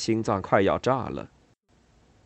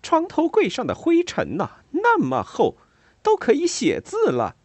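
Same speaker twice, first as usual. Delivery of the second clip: high, loud scolding complaint; indignant gossip; 5 dB louder than usual. A narrator's reading. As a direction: play it up for the customers. Ada scolding to customers.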